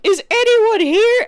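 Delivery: put-on high, loud, drawn-out kid's holler; mock yell calling through a door; imitated yelling. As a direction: yell it out slowly, imitating the kid. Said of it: "then she starts yelling".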